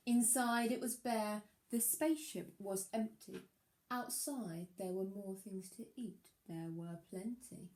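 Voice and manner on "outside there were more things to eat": ordinary delivery, nothing marked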